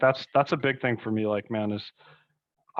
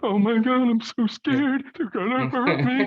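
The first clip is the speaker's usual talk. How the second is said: mock scared; 10 semitones higher than usual.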